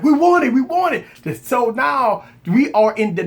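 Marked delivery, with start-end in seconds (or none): 0.0-1.4 higher mock chant; impatient crowd. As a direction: whine like eager buyers. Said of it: "A lot of people like".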